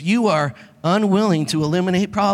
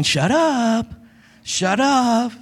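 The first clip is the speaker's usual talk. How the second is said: spirit urging; much slower than usual. Retold or imitated.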